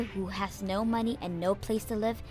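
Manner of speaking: plain reading voice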